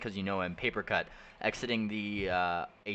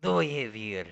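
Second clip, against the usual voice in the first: bad French accent; 3 semitones higher, 4 dB louder than usual. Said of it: "in my shitty fucking French accent".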